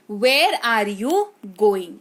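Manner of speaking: very slow, clear teaching read; slow model sentence. Shown as a demonstration. Teacher modelling sentence slowly for students. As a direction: say Where are you going? word by word. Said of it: "teacher repeats model sentence".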